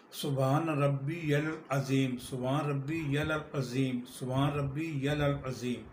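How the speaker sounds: slow, measured سبحان ربی العظیم with every syllable clear; careful recitation. Shown as a demonstration. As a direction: say سبحان ربی العظیم deliberately, drawing out the vowels. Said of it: "عربی صحیح مفہوم میں پڑھیں گے تو".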